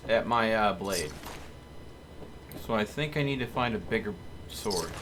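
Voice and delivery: ordinary talk, nothing marked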